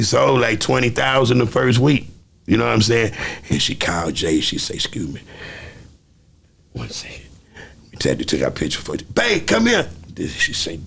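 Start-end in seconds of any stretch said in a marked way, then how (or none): 4.8-7.2 retold quiet aside. Retold polite aside during talk. 9.1-9.9 high-pitched mimicked summons; imitated call to partner. Retells wife calling husband over.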